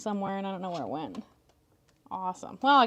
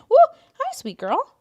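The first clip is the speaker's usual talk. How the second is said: high, slow, sweet pet voice; cat talk; 11 dB louder, slower than usual. Real, not acted. Greeting the cat on the desk in a high slow coo.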